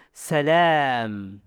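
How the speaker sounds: "Salam" with a long, open, forward a; open mouth-cavity voice. Shown as a demonstration. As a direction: release "Salam" from the open mouth, not the throat. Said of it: "tapi suara al-jauf".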